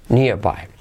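ordinary talk

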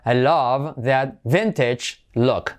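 very slow English example, word by word; teacher spacing out each word for learners; slow model sentence. Shown as a demonstration. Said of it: "sería".

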